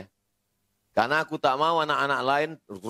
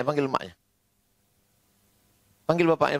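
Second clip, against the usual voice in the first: ordinary talk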